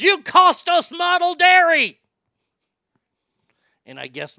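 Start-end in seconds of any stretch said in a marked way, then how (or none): 0.0-2.0 much higher, louder and slow, a mock outraged boss. The GM blaming the hosts for a lost advertiser, a hammed-up outraged yell. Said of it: "He goes".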